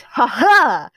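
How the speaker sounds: loud, high "ha ha"; triumphant laugh. A narrator's reading. Roman gloats over being taller, loud triumphant laugh. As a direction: crow a big theatrical laugh. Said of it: "Roman laughed".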